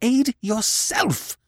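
high, slow, fierce cry. Acted. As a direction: spit it at him fiercely. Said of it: "she cried fiercely".

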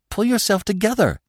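high-pitched, anxious plea; pleading, pitched right up; urgent begging. A narrator's reading. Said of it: "Bambi begged".

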